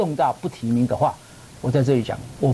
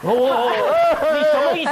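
mock offended; 11 semitones higher, 4 dB louder than usual. Real, not acted. Mock offense after teasing.

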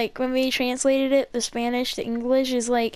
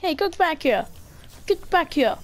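mock chasing call; 4 semitones higher than usual. Calling after an opponent.

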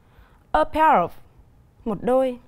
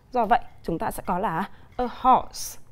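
ordinary delivery

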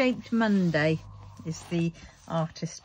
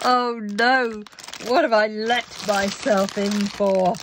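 overwhelmed groan; 3 semitones higher, 7 dB louder than usual. Real, not acted. Overwhelmed by the kit.

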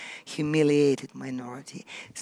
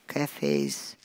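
usual speaking voice

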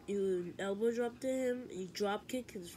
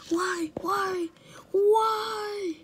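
high wailing whys, getting louder; crying why; 11 semitones higher, 8 dB louder, much slower than usual. Acted. Letter told he is next, crying out why. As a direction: plead through tears.

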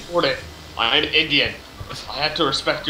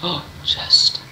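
ordinary delivery

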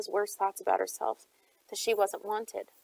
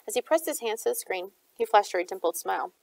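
ordinary delivery

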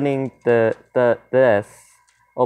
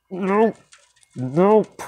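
high, rising nopes; tense little upward yelps of refusal; nervous refusal; 6 semitones higher than usual. Real, not acted. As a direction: refuse nervously, pitch climbing. Fending off threat in horror game.

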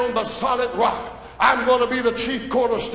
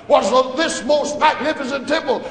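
ordinary talk

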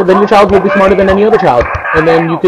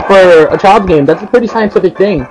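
ordinary delivery

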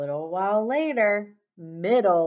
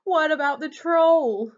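high, loud worried goat; raised voice, anxious question; alarmed cry; 10 semitones higher, 7 dB louder than usual. A narrator's reading. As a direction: cry it out, fearing the troll. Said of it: "cried Middle Billy Goat Gruff".

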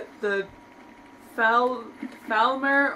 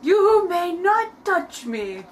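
haughty refusal; 6 semitones higher, 4 dB louder, much slower than usual. Pretend. Voicing a snooty character in game.

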